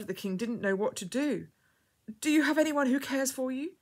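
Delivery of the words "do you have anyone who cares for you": urgent concern. A narrator's reading. Urgent question to distressed girl.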